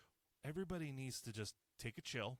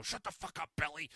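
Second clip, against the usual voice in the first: mock yell at kid; 4 semitones higher, 7 dB louder than usual. Mock yelling at a toddler.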